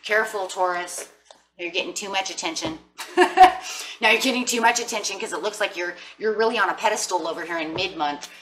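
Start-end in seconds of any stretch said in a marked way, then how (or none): 3.0-4.0 loud, high cackling laugh; high-pitched real laugh. Real, not acted. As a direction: burst out laughing after a tease. Laughs after teasing warning.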